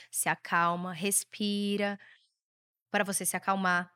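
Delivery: very slow, soft calming voice; mother soothing an angry son, soft and slow; calming a child. Shown as a demonstration. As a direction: show the kid how to breathe and settle down. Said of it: "Olha como você tem que fazer".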